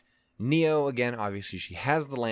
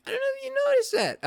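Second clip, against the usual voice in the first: squeaky joke aside; 4 dB louder, much faster than usual. Acted. Joke aside about loving Ruby.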